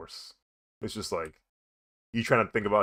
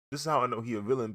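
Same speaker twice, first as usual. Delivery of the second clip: usual speaking voice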